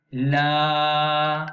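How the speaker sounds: La stretched long and loud; long vowel. Shown as a demonstration. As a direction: draw La out long. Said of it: "Dibaca panjang". (La dibaca panjang)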